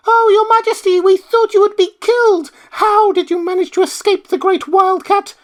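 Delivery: very high, loud squeaky mice voices; anxious mice. Acted. Mice rush up relieved their Queen is alive, high squeaks. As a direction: gasp out relief like tiny mice.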